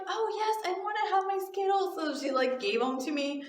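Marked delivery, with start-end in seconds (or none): 0.1-2.0 put-on high, quick child voice; pitched-up little-girl reply. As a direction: imitate an eager small kid. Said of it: "she was like".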